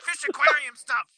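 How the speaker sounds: dumb fan voice. Retold or imitated. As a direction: play an eager fool urging everyone to drink. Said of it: "somebody's like".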